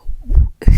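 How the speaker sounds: sharp imitated gasp; mock gasp. Aunt gasping at the niece's weight, mock gasp. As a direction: gasp like a shocked auntie. Aunties gasp at her size.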